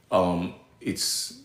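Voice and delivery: ordinary talk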